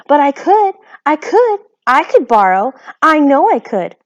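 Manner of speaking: high, loud eager pleading; girl eagerly insisting she can; eager insistence. A narrator's reading. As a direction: insist breathlessly, full of hope.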